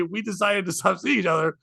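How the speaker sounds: ordinary talk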